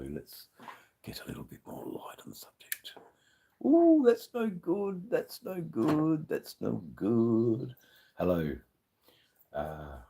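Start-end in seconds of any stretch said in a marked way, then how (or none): 0.1-2.9 hushed whisper. Real, not acted. Talking to viewers, whispering while fixing the light. 3.6-7.8 a squeal of dismay that winds down; dismayed ooh. Real, not acted. Seeing the light is bad, dismayed and drawn out.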